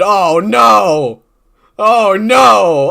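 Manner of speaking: loud mock-horrified cry; mock alarm. Reacting to a Reddit insult with a mock-horrified cry. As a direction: ham up the horror.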